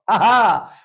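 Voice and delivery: loud demo laugh; two big ha has, full of air; hearty ha ha. Shown as a demonstration. Lecturer leading the crowd in a loud laughing exercise. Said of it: "한번 저를 따라해보세요".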